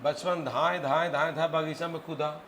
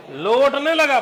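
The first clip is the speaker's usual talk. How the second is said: slow, loud, higher hammed-up wail; mock wail; 9 semitones higher, 12 dB louder, slower than usual. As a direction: drag it out like a beaten kid rolling on the ground. Boy gets beaten, rolls around.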